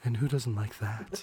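man lightly voicing a woman; a hint of a woman's voice, laughing; girl voice. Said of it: "You're getting me a little hot now".

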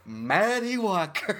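slow, drawn-out mad scientist; mock-menacing mad scientist. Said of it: "Walt looked at me and went".

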